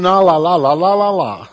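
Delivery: sing-song la-la-la trailing down; mock bickering babble. Preacher mimics squabbling over emphasis, dismissive babble. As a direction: rattle off dismissive la-las, dropping away at the end.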